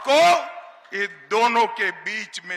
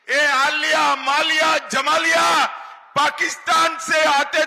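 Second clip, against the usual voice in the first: booming political rally speech; 6 semitones higher than usual. Real, not acted.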